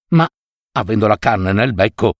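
usual speaking voice

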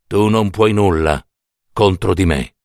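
low, measured figure of Death; Death's voice. A narrator's reading. Death tells the mother she is powerless, low and grave. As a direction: state it as all-powerful Death, in a low pitch. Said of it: "disse la morte".

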